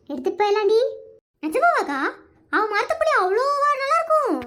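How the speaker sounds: ordinary delivery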